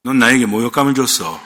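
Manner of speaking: low, dramatic movie-boss impression; 김영철. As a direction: drop deep for the famous film line. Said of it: "아, 김영철?".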